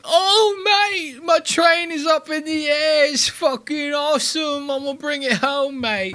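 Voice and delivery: high, mocking put-on British accent; squeaky British fan voice, hammed up. Mocking a British train fan in a high British accent. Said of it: "and he's like".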